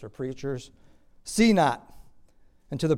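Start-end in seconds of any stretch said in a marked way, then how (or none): 1.2-1.9 loud, raised, curt order; forceful pulpit quote; acted command. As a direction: call it out as a rebellious people. Acts the people rejecting their seers.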